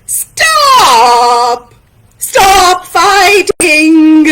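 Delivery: loud, drawn-out shout; yelling. Acted. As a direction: bellow to break up a fight, stretching each word. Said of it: "and he yelled".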